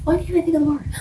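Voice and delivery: annoyed she can't find the word; exasperated at herself. Real, not acted. Podcaster, losing a word mid-recap, exasperated high. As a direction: pitch jumps high in self-frustration.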